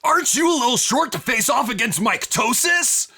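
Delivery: high, loud mocking brute voice; taunting giant. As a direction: sneer down at the little goat. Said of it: "Mycotosis looks down at you and goes".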